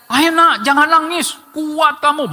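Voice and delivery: high, mock-encouraging no crying; imitating a parent. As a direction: urge the child to be strong. Parent urges child not cry.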